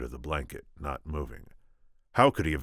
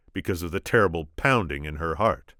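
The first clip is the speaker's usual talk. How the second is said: ordinary delivery, nothing marked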